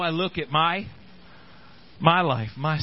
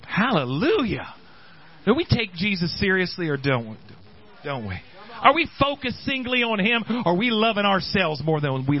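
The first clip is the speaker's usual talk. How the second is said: lightly raised preacher voice; preaching exclamation; 4 semitones higher than usual. Acted. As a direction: call out to the church.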